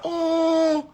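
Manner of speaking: high, fake pitying coo; mock pity. Refusing to coddle his wife, high mock coo. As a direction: coo sympathy mockingly. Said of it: "I'm not".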